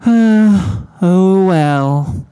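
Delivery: long sighed mock-dramatic "Oh well"; hammed-up woeful sigh; overdramatic sigh. A narrator's reading. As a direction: heave it like a stage martyr. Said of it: "with an overdramatic sigh".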